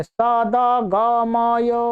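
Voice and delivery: सादा गामाया said slowly with an added a after द; mock wrong pronunciation. Shown as a demonstration. Teacher showing an error seen in people's statuses, slow. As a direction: stretch सादा गामाया to show the mistake. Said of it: "ऐसा इसमें अ जोड़ देते हैं".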